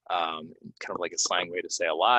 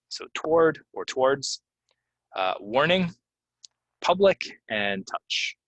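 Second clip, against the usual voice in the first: toward, warning, public, touch each raised and stressed; emphatic word list; 6 semitones higher, 4 dB louder than usual. Shown as a demonstration. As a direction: call out the key words. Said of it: "teacher highlighting words".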